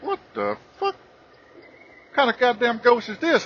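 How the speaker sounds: high, slow, thin yelp of shock; startled shock. Retold or imitated. Man sees a naked ghost in his room, startled high cry. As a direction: recoil at the sight of a ghost. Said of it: "it took me by surprise".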